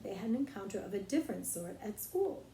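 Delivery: ordinary delivery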